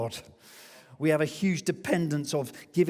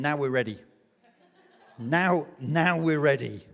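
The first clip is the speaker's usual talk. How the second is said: emphatic declaration; 5 dB louder than usual. Acted. God declaring readiness, louder.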